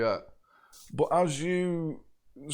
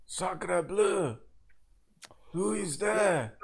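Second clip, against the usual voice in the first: higher-pitched French exclamation; French accent; 5 semitones higher, slower than usual. Acted. French prisoner calling from another cage, French-accented surprise. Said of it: "you hear a European, a French accent".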